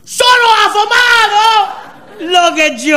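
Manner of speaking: high, slow, thrilled echo of "Sono affamato"; delighted Italian waiter. Retold or imitated. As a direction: sing "Sono affamato" back like an overjoyed waiter.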